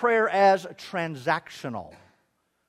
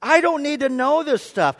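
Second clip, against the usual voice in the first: exasperated protest; 7 semitones higher, 5 dB louder than usual. Retold or imitated. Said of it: "I'm like".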